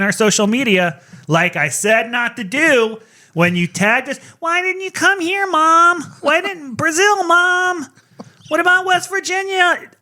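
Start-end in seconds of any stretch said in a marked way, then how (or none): 4.4-9.9 high, whiny pleading fan. Retold or imitated. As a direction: whine at Mom like a needy kid. Mocking fans messaging them.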